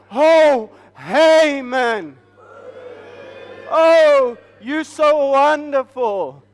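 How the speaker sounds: loud, gushing admiration for Haman; loud fawning praise of a proud man; fawning crowd. Retold or imitated. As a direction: cheer him on like an adoring crowd.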